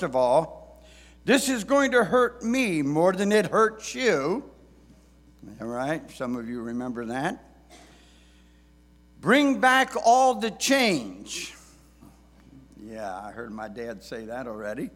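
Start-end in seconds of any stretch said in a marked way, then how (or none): 1.2-4.5 loud, high scolding father; stern dad voice. Retold or imitated. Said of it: "words that many dads have said". 9.2-11.5 loud, high and slow fatherly order; stern dad voice. Retold or imitated. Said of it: "words that many dads have said".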